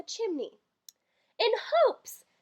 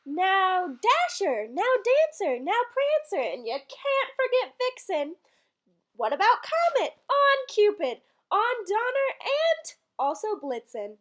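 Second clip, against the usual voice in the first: slow, called-out roll of names; calling reindeer names; 2 semitones higher than usual. Acted. Santa calling his reindeer in the poem, called out. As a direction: call each reindeer like a driver.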